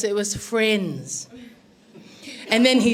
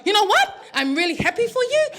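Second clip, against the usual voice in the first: high-pitched mock patience; exasperated mother; 9 semitones higher than usual. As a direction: retell a mum humouring her son before scolding. Mother confronting lovestruck son.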